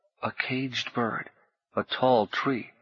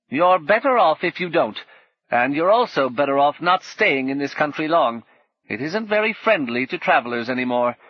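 put-on a bitter old woman, pitched higher and louder; 5 semitones higher, 5 dB louder than usual. Old woman complaining to Paul, higher and louder. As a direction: grumble like a worn-out old crone.

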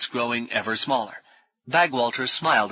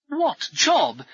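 high, incredulous "What job"; amazed echo of the question; dumbfounded. A narrator's reading. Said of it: "Brummond seemed dumbfounded".

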